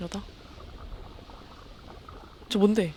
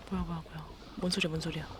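scared hush; 3 semitones lower, 9 dB quieter than usual. Real, not acted. Scared by sound in dark.

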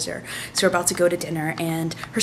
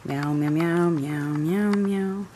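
cat meows; 4 semitones lower, 3 dB quieter, slower than usual. Retold or imitated. Imitating a cat.

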